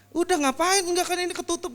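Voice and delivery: high, loud scornful jeer; mock him like a haughty guest; mocking pastor. Pastor mocking the cameraman wiping the table, high scornful jeer.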